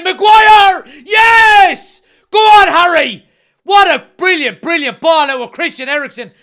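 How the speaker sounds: screaming with joy, high and loud; goal celebration shout. Real, not acted. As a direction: roar the goal.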